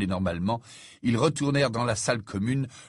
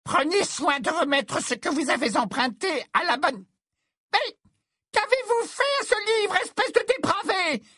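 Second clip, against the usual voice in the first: very high screeching scolding voice; piercing, indignant put-on screech; outraged librarian; 3 dB louder than usual. A narrator's reading. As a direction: shriek in scandal at the culprit. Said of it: "dit-elle d'une voix sifflante".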